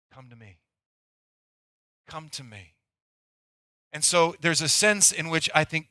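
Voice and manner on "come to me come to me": much softer, gentle and low; tender invitation. Acted. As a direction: call them in softly.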